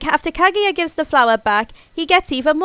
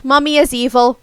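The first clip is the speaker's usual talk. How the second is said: usual speaking voice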